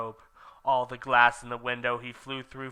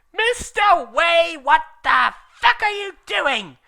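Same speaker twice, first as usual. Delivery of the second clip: shrill, very high furious yell; angry shout; 9 dB louder, slower than usual. Acted. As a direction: scream at the student in rage. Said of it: "Rumbridge shouted angrily".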